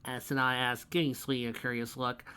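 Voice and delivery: ordinary delivery, nothing marked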